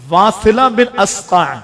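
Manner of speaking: slow, syllable-by-syllable واصلہ بن اسقع; careful name. Shown as a demonstration. Preacher teaching the congregation a name, said slowly. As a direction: draw out each sound of واصلہ بن اسقع. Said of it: "میں اس کی pronunciation بھی بتاؤں".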